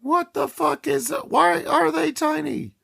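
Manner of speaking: high, stunned outburst; stunned by the screen; shocked. Real, not acted.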